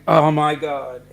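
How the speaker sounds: loud retold gasp of surprise; sudden loud astonishment; shocked oh my god. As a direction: blurt it out, amazed. Retold shock at rich carpet layer.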